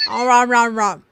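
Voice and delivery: chewing while talking; mouth full. Real, not acted. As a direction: talk through a mouthful. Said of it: "My mouth is full".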